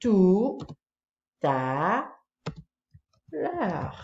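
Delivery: à, tout, à, l'heure typed one word at a time with pauses; spelling it out. Shown as a demonstration. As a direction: dictate each word slowly as you type.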